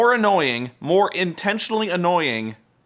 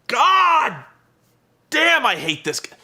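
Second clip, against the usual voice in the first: much higher, louder frustrated groan; exasperated outburst; 9 semitones higher, 4 dB louder, slower than usual. Real, not acted. Venting at viewers about Eric Young, exasperated outburst. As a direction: blow up at how annoying he is.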